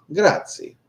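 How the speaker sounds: high, bright Italian-accented grazie. Shown as a demonstration. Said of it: "I'm always saying grazie".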